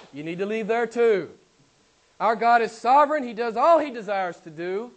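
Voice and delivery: ordinary talk, nothing marked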